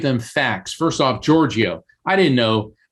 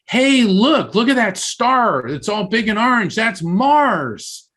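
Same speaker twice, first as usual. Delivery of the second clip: excited son; 11 semitones higher, slower than usual. Retold or imitated. Mother retells her son spotting Mars, high excited voice. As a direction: imitate a young son pointing out a star, high and bright. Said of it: "And my other son said".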